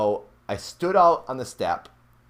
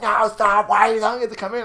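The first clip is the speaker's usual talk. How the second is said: put-on scared girlfriend; 13 semitones higher, 9 dB louder, faster than usual. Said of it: "she's like".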